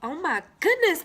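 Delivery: loud high oh my goodness; surprised. Real, not acted. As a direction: gasp it out in surprise. Card surprise.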